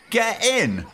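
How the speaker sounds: high, sudden triumphant cheer; gleeful cheer. Real, not acted. Podcaster celebrating the buffalo blindsiding the hunter, gleeful cheer. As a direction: cheer like your side just scored.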